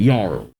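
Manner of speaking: Jarl with an opening Y, "yarl". Shown as a demonstration. Reader showing the name's sound, Jarl said "yarl". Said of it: "the J is a Y".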